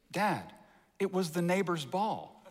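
lightly voiced little boy breaking the news; imitated young son. Son confesses to his dad after the swat, imitated meekly. Son's reply voiced in story.

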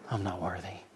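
low, very soft acted prayer; humble confession. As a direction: drop to a hushed, lowly admission. Humble tax collector's prayer acted out.

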